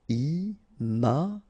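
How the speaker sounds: slow "Imaginaire", i-ma-gi-naire; syllable by syllable. Shown as a demonstration. French teacher modelling for learners, "Imaginaire" slowly syllable by syllable. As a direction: separate all four syllables of "Imaginaire".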